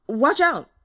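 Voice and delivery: high, loud warning; warning shout. Acted. Boss warning the others of an attack, loud shout.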